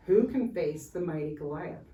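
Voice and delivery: plain reading voice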